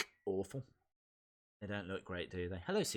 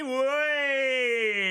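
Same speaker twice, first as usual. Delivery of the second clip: higher, louder whey; mock cheer; 7 semitones higher, 4 dB louder than usual. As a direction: cheer like a drunk mate. Cheering a drinker in chat.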